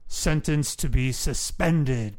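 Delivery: loud, slow judicial pronouncement; judge's booming, deliberate ruling; judge announcing the sentence. Acted. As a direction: draw it out loudly for the whole courtroom.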